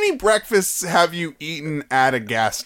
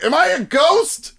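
confused ghost; 14 semitones higher, 5 dB louder, faster than usual. Retold or imitated. Imagined naked singer voiced aloud.

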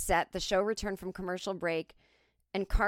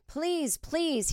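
pleading over applause; 8 semitones higher than usual. A narrator's reading. Comedian to applauding crowd, pleading lightly. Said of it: "he said over their applause".

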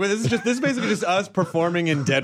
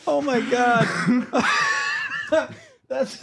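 laughing hard; 8 semitones higher than usual. Real, not acted. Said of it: "laughing hard".

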